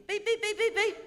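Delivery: beep-beep-beep like the machine going off. Retold or imitated. Detector beeps again, second pass.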